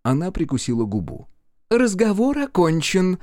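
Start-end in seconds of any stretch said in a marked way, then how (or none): 1.7-3.2 high, deliberate, final refusal; cutting dismissal. A narrator's reading. Brenda ends the detective's questioning, cutting dismissal. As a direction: shut the conversation down. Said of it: "отрезала Бренда".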